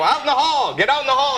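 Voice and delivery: teacher banishing the class clown to the hall; teacher ordering out. Retold or imitated. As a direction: send the kid out like an exasperated teacher. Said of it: "she would go".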